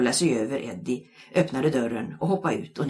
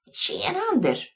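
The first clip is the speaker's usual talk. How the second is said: put-on high squeaky boy voice; squeaky greeting. A narrator's reading. Krille squeaking a greeting from the back bench. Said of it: "pep Krille".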